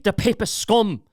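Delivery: imitating great-grandmother in a poor Irish accent; bad Irish accent. Great-grandmother's hateful saying in a bad Irish accent. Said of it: "Can't do a very good Irish accent".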